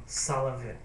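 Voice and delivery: usual speaking voice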